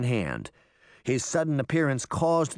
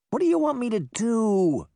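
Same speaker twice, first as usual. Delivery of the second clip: high, exasperated complaint; put out; 11 semitones higher, 4 dB louder than usual. A narrator's reading. Pinocchio to the children asking his help, put-out and high. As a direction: voice the request as a great imposition. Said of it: "he felt very put out".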